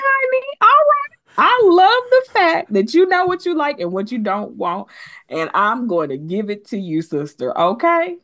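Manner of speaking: high, sugary placating voice; high coaxing voice, humoring her demands; sweet-talking a diva. Retold or imitated. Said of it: "And I would be like".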